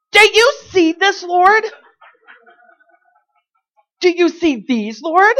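shoving the checkbook at heaven in protest; exasperated shouting. Retold or imitated. Holding up her checkbook to the Lord, loud exasperated complaint. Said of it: "I really do talk to the Lord like this".

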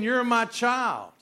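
loud, high and drawn out; ringing, slow declaration from the pulpit; emphatic proclamation. Acted. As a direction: proclaim it slowly at full voice. Preacher proclaims God's words at full force.

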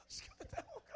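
tiny, very high, soft voice; hushed squeak. Acted. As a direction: squeak it quietly, as a secret to God. Same complaint repeated under breath.

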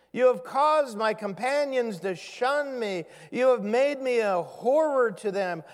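steady, earnest reading of a lament; scripture reading. Acted. Pastor reading the psalmist's lament to the congregation, earnest. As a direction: read the psalm out with weight.

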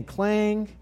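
high clang at ordinary volume; imitated clang. Preacher repeating the knife's sound as baby calms, high clang. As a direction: sing out the clang without extra force. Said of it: "this very loud clang sound".

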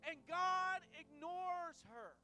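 loud, high, impassioned preaching; preaching at full force. Acted. Pastor rousing the congregation, loud fervent preaching. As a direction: whoop it up like a revival preacher.

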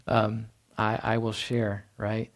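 mock reluctance, dragging the words out; reluctant.

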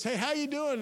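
mock cheerful "how you doing"; breezy greeting. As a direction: toss it off brightly. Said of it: "You ask people, we get so cliches".

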